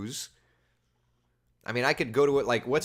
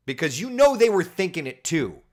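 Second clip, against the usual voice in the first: normal talking voice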